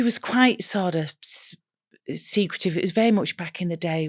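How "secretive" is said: ordinary delivery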